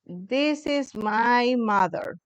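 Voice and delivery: slow, careful English reading of "This is my mother"; teacher slowly models "This is my mother". Shown as a demonstration. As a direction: spell out "This is my mother" slowly for the class. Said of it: "This is M Y, mother".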